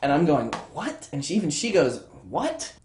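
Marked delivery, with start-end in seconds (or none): 0.7-1.1 high, soft questioning "what?"; baffled disbelief. Retold or imitated. Teller's reaction to absurd twist. 2.3-2.7 high, soft questioning "what?"; baffled disbelief. Retold or imitated. Character equally baffled by twist.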